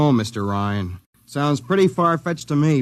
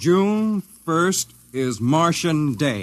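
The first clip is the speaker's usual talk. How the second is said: slow dictation; much slower than usual. Acted. Repeating the slogan for notes.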